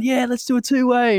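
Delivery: high, eager call to a fellow flyer; eager invite. Retold or imitated. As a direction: sell it like a keen kid. Inviting next flyer mid-race.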